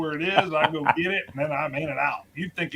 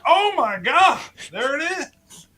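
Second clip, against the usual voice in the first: stunned amazement; 9 semitones higher, 5 dB louder than usual. Retold or imitated. Finding bottle exactly where said.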